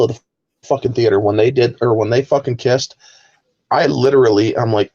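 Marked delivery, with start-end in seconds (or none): none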